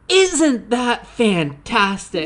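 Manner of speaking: imitating a thrilled old historian; excited. Historian reacting to the porn stars, excited slow gush. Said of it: "he was just so excited".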